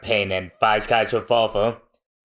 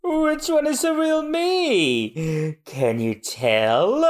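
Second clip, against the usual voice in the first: slow, teasing, pitched up; sly high taunt; 6 semitones higher, slower than usual. Acted. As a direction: tease them, drawn out. Mister Three taunts.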